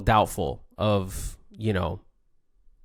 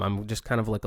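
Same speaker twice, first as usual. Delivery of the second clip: ordinary delivery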